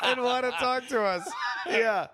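high, loud laughing shout; excited shout. Real, not acted. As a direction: shout it out, cracking up.

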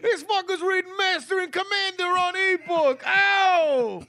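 high teasing cry; mock exclamation. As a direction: jeer at him like a heckler. Teasing friend loudly.

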